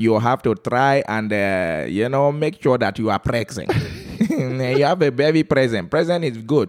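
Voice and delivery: put-on heavy comic Calabar accent. Guest advises husbands to be present, thick Calabar accent. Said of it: "a very thick Calabar accent".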